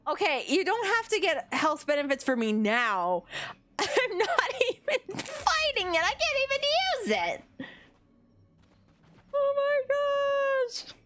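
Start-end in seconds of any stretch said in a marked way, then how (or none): 3.8-6.6 high words through laughter; laughing hard. Real, not acted. Streamer giving up on fighting it, laughing hard. Cracking up again. 9.3-10.9 very slow, high squeal; weak from laughter. Real, not acted. Streamer after a laughing fit, drawn-out squeal.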